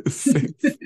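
high, loud laugh into the word; word broken up by laughter. Real, not acted. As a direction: crack up while correcting yourself. Laughing while correcting the joke.

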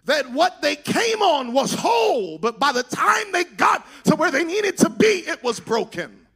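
high, loud, chanted preaching over the organ; full-force preaching. Real, not acted. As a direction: preach it to the rafters with rising fervor. Preacher builds to full-force climax.

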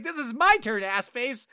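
high, loud indignant yelling; yelling at an unfair enemy, hammed-up anger; mock furious yell. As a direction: shout it like the game cheated you. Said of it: "I was like".

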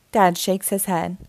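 plain reading voice

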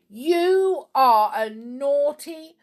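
loud, high, stern scolding; cross telling-off; severe. A narrator's reading. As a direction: scold severely, leaning on each word. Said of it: "said Snap severely".

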